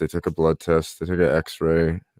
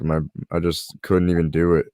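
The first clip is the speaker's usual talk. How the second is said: normal talking voice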